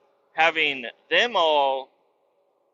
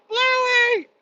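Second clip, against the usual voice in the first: imitating a little girl shrieking her toy's name, very high; a piercing child's wail for a stolen toy; 5 dB louder than usual. Daughter shrieking because the puppy stole her toy, high wail. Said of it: "She's like".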